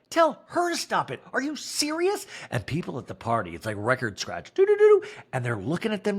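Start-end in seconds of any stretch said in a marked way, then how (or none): 0.1-2.3 much higher incredulous shriek; mock outrage. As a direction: shriek in disbelief at her mom. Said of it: "And Monica's like".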